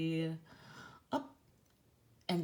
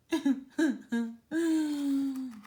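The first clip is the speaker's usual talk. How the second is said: high amused laugh; 7 semitones higher than usual. Real, not acted.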